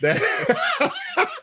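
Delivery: cracking up. Real, not acted. Explaining why Ryan became Sluggo, bursting into laughter. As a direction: break into a laugh on the word.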